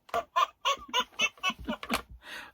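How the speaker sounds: high giggle; high laugh. Real, not acted. Woman laughs again at the pun name.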